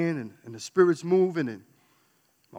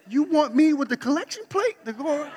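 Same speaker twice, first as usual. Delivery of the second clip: laughing disbelief; 10 semitones higher, 5 dB louder than usual. Retold or imitated. Ex-robber handed the collection plate.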